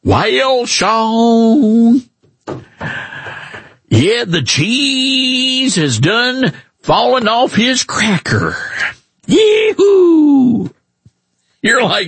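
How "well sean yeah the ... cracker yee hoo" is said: high, slow, hammed-up Southern twang; mock a folksy Southern senator, stretching every word; over-the-top hillbilly Southern accent, ending in a whoop; John Kennedy's Southern drawl. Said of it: "And then now that he's a Republican".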